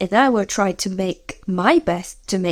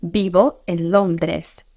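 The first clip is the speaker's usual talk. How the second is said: slow, clear Spanish sentence; slow model phrase; slower than usual. Shown as a demonstration. Teacher modelling where you live, slowly. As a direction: spell it out slowly for beginners. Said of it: "or a city".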